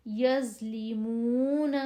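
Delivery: یظلمون with a full, heavy ظ, drawn out slowly; thick zoe. Shown as a demonstration. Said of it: "زو کی آواز موٹی اور نرم".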